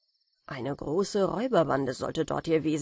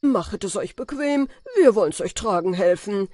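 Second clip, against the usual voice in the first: much louder, mock-courteous bandit voice; mock polite robbers; 3 semitones higher, 7 dB louder than usual. Compass-smith playing robbers asking travellers politely, loud and mock-courteous. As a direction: ham up the robbers' fake politeness. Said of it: "und fragten ganz höflich".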